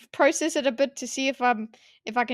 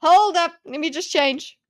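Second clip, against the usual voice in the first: raised, lively imitation of a flip-flopping voter; hammed-up hasty backtracking, higher and louder; mock voter; 5 semitones higher, 6 dB louder than usual. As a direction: play casual approval tipping into comic alarm. Acts out a voter changing their vote.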